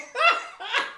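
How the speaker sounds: short high burst of laughter; a quick high amused laugh. Real, not acted. Laughs after infection joke.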